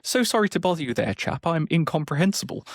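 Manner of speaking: high-pitched hammed-up British gent; British accent. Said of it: "could have a British accent".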